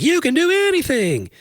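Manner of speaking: high, soaring hype voice; mock motivational cry. Preacher hypes believers with a sports scripture, mock soaring cry.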